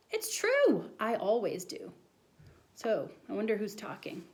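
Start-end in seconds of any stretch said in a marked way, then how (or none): 0.1-1.9 put-on insistent boy. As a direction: plead it like a kid.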